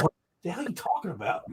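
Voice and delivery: high, fast, baffled outburst; retold disbelief. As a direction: fire it off in disbelief. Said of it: "I'm like".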